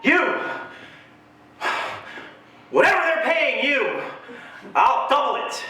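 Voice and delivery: high, emphatic, deliberate offer; pushy mom. Retold or imitated. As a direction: lean in and make the offer. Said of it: "She's like".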